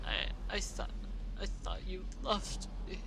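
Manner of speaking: hurt whisper. Acted. Heartbroken filly after outburst.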